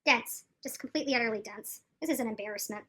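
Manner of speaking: ordinary talk, nothing marked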